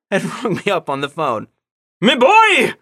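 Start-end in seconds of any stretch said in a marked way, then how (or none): 2.0-2.7 high, loud hail from a jolly uncle; boisterous, hammed-up British uncle greeting. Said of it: "in a voice that may have been the one he used last year".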